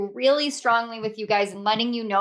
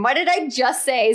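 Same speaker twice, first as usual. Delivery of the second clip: ordinary delivery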